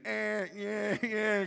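put-on high, loud baby wails; imitated crying babies. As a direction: mimic a chorus of wailing babies. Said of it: "'cause these babies were turned away".

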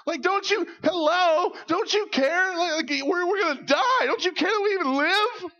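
high, loud frantic pleading; terrified disciples shouting at sleeping Jesus; panicked disciples. Retold or imitated. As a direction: beg like you're about to drown. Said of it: "they go to Jesus, like".

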